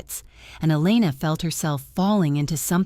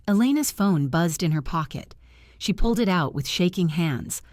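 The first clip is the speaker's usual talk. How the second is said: usual speaking voice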